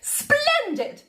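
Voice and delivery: very high, grand exclamation; a queen thrilled by a clever idea; delighted Queen. A narrator's reading. Queen approving Sam's plan, delighted.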